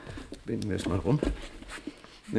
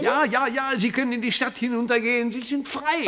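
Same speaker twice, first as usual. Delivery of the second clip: very high, bubbling reassurance; joyful excitement; 11 semitones higher than usual. Acted. Professor telling Maggie she is free, high and joyful. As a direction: tell her she's free, beaming.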